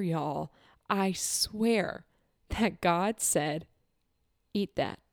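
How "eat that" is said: a soft, solemn instruction; hushed divine command. Retold or imitated. Retelling God's word about the baguette, hushed.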